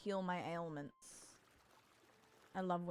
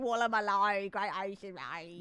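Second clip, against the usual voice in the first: put-on Australian accent; 5 dB louder than usual. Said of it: "My Australian accent is peak".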